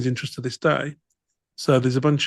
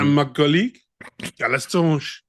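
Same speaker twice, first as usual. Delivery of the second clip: mock French accent. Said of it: "I want them to team up".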